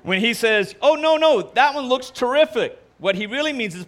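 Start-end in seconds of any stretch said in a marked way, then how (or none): 0.8-2.8 fake enthusiasm. Husband fakes enthusiasm about outfit.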